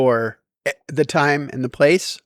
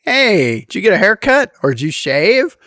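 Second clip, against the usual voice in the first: breezy polite greeting; 6 semitones higher, 4 dB louder than usual. Retold or imitated. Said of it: "what I meant to say was something smart".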